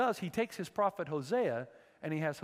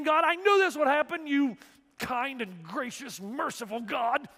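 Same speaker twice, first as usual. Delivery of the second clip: high, loud complaint that slows down at the end; whining Jonah; 9 semitones higher, 7 dB louder than usual. Acted. Prophet Jonah griping to God about his mercy. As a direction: sulk at God like the pouting prophet.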